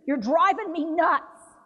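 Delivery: worn-out caregiver telling him off; exasperated caregiver. Retold or imitated. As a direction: snap it out in frustration. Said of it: "turn around at some point and say".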